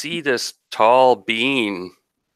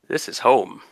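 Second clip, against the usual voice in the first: light foreign lilt, higher; strange Latin accent; 4 semitones higher than usual. Acted. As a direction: say it with a faint exotic accent. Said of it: "she says in that strange Latin accent".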